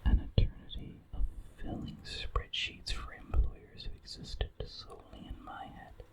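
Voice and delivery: ordinary delivery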